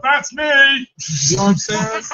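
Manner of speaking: high, quick mock call-out; eager claim. Homeless guy claiming somebody else's order, mock eager.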